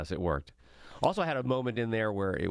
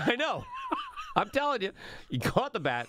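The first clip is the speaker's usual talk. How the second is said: much higher, excited disbelief; excited insistence. Real, not acted. Telling the bat story to friends, excited and high. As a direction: insist it really happened.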